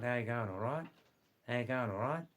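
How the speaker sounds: Australian "How you going" squashed into one word; quick, run-together Aussie "How you going"; broad Australian accent. Shown as a demonstration. Showing listeners the Monday greeting, broad Australian accent. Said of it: "the other half talk like this".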